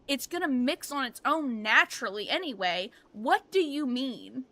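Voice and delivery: retold exasperation, slowing on the final question; exasperated. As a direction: argue in baffled, mock-outraged disbelief. Retelling exasperated argument with child.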